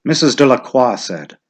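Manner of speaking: ordinary delivery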